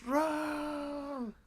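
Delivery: high crying cry of Run; hammed-up panic cry; mock panicked yell. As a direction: yell like fleeing townsfolk. People fleeing dragon voiced.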